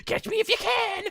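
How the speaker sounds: high, mocking sing-song taunt; teasing taunt. Big Bird reject taunting Goku in a high mocking voice. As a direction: tease him like a cheeky bird, way up high. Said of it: "the Big Bird's like".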